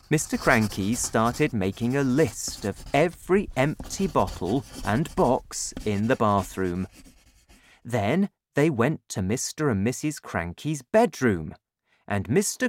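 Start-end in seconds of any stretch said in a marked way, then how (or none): none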